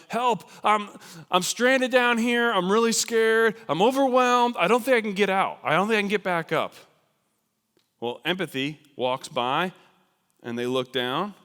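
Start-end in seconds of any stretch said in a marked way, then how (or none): 0.1-6.7 loud, high panicked cry from a ledge; scream for help. Acted. Stranded person screaming up the cliff, panicked. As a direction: yell up in fear, stranded. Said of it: "they scream up".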